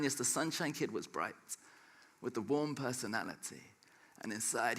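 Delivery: rhythmic spoken word performance; spoken word poetry. Acted. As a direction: perform the poem with rhythm and drive. Performs his poem The Sunshine Kid.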